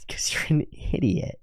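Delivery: deadpan whisper; flat whispered put-down. Retold or imitated. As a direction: breathe the insult, unimpressed. Eris's cold reply.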